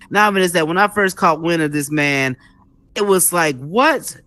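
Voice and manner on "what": high-pitched mock disbelief; baffled reaction. Host recalling first hearing of this man, mock bafflement. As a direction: retell a puzzled first reaction, rising high into each question.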